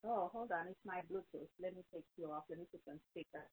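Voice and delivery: muffled, distant bad phone line; faint caller on a bad Bluetooth line; in a tunnel. Real, not acted. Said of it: "sounds like you're in a tunnel".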